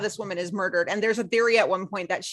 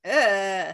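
disgusted groan; 3 semitones lower than usual. Retold or imitated. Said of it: "I was like".

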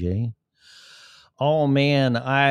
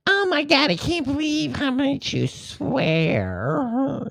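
shrill scandalised squeal, slow; high prissy voice; slower than usual. Pretend. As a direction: gasp like a prim lady.

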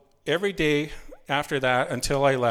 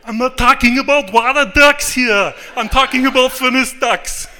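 put-on annoyed high, very loud Austrian-accented shout; Arnold Schwarzenegger; 11 semitones higher, 13 dB louder than usual. Exasperated telemarketer correcting a customer about ducks, Schwarzenegger shout. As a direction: bellow it in a thick Austrian action-hero accent. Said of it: "They almost sound like Arnold Schwarzenegger".

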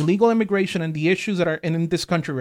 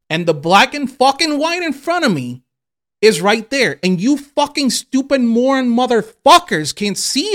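loud, higher furious rant; heated rant; 6 semitones higher, 6 dB louder than usual. Real, not acted. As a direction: tear into the online critics at full volume. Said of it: "Sorry that I got a little heated".

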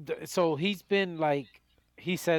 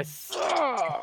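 "my sup" stretched out slowly; drawled own sup; slow drawn sup; 7 semitones higher than usual. Retold or imitated. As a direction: let "my sup" drag. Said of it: "you hear the little sup".

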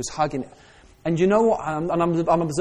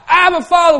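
Abba, Father cried out high and loud; loud cry; 13 semitones higher, 10 dB louder than usual. Shown as a demonstration. Preacher shows the real cry to the congregation, loud and high. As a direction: cry it out with all your heart. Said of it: "No, it's a cry".

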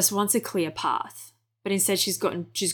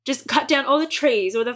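frustrated outburst; 5 semitones higher, 6 dB louder than usual. Real, not acted. Desperate outburst.

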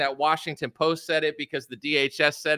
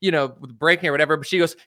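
ordinary talk, nothing marked

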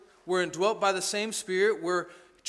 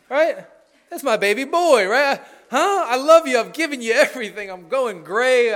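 exasperated parent; 6 dB louder than usual. Retold or imitated. Parent to sullen teenager, loud exasperated pleading. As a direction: pour out a parent's wounded love. Parent's hurt outburst to teen.

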